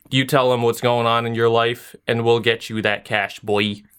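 ordinary talk, nothing marked

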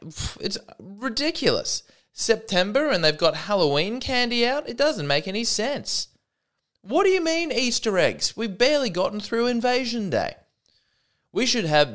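high, indignant grumble about the holiday aisles; mock whiny complainer. Mocking people who complain about seasonal products.

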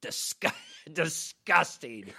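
slow, drawn-out mock disgust; disgusted grandma. Grandma looking him over, slow mock disgust. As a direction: imitate a grandma's disgust, lingering on the word. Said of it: "looks at me and she goes".

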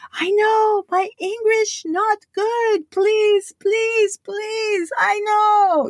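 put-on high, desperate Japanese-accented plea; pleading Japanese accent. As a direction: beg the crowd in a Japanese accent. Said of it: "he's just like".

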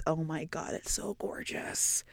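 breathy awed whisper. Real, not acted. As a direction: whisper it like a secret treasure. Dreamy about Sausalito.

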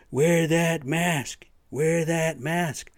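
drawn-out scolding taunt; mask shaming. Pretend. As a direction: call it out across the store to shame them. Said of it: "mask shame somebody".

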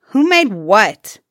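loud, brusque question; rude demand. A narrator's reading. Suyatar challenges the king's son, demanding rudely. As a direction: demand it rudely, hitting the words hard. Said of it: "Suyatar demanded rudely".

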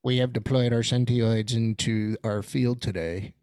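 flat, low, mechanical announcement; robot voice. Pretend. Speaks as the Sentioid robots.

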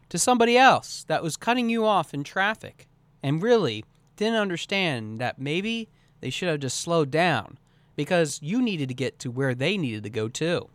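ordinary delivery

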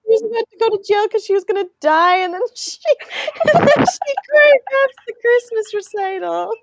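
high, fast, breaking with laughter; trying to finish the story through giggles; laughing while telling. Real, not acted. Retelling the prison recital to a friend, laughing.

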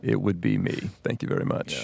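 low, breathy, soft and husky; sexy voice. Acted. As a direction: purr it low like a crooner. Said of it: "You got your sexy voice on".

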